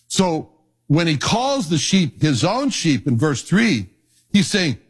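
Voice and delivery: normal talking voice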